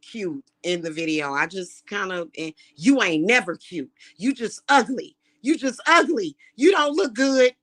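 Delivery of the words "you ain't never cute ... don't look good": mocking a hater's taunts, higher and louder. Mocking the online hater who calls her ugly, higher and louder. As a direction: sneer out the insults like a troll.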